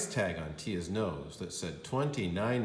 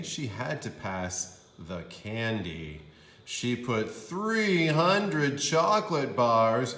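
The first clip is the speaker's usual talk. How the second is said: slow, louder on three hundred; storyteller's dramatic pause-y stress; slow emphatic buildup; 3 semitones higher, 5 dB louder, slower than usual. A narrator's reading. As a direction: savor the huge number. Narrator building mischief.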